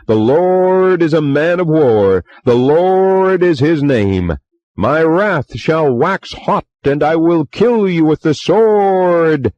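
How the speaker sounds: high, slow, loud pulpit bellow; bellowing preacher. A narrator's reading. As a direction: boom it out like a ranting preacher. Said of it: "when he bellowed".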